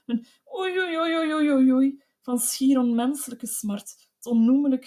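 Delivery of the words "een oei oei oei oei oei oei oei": ghastly wailing oei. A narrator's reading. Beaten wife wailing oei oei in agony. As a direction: wail like a wounded animal. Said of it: "een akelig en aanhoudend gehuil".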